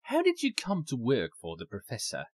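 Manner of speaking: ordinary delivery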